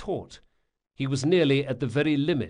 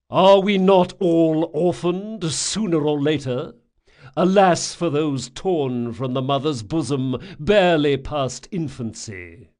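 loud, raised orator's lament; bellowing priest; 4 semitones higher, 8 dB louder than usual. A narrator's reading. Eyeless priest begging for orphans on temple steps, bellowing. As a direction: preach it to the whole square in a battlefield voice. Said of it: "he bellowed in a voice that would have been at home on a battlefield".

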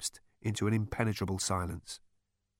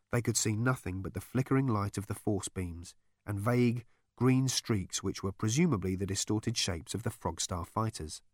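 usual speaking voice

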